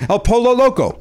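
"Pollo" said with a hard L, POH-lo; anglicized pronunciation. Shown as a demonstration. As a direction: say the L in "Pollo" as in English. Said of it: "Spanish food that has an L in it".

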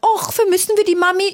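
high cooing voice for the dog; baby talk to dog. Retold or imitated. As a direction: coo it like talking to a puppy. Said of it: "wie die da irgendwie liegt und sagt". (hohe Babystimme für den Hund)

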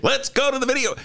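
high hammed-up sportscaster call; sportscaster catchphrase. Imitating a nineties sports guy, high announcer call. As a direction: belt it like a nineties sports anchor. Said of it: "there was a guy who used to do that".

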